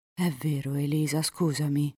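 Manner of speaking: ordinary delivery, nothing marked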